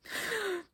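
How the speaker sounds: quick startled intake of breath; sharp high gasp. Acted. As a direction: gasp in shock at the dragon. Reader reacts to huge dragon.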